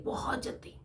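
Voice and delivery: बहुत जल्दी breathed in a soft whisper; whispered echo. Real, not acted. Echoing her point to listeners in a whisper.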